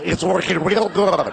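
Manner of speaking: prank caller speaking through water; wobbly, burbling words as if submerged; bubbling underwater voice. Acted. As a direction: gargle the line like you're underwater. Same gag, to a nurse.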